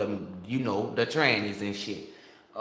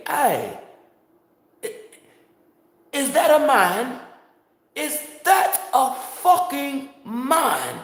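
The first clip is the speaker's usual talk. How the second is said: high-pitched, loud, drawn-out incredulity; shocked disbelief; 13 semitones higher, 5 dB louder, slower than usual. Retold or imitated. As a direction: stretch each word in scandalized shock. Acts out a man's shocked discovery.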